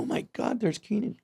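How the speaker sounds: whispered, starstruck; awed whisper. Retold or imitated. New cast member seeing Kenan, awed whisper. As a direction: breathe it like a starstruck rookie. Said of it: "awestruck newcomer".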